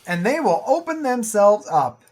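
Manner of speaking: high, loud building to voila; grand voila; triumphant. Real, not acted. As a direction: announce it like a magic trick. Triumphant airlock reveal.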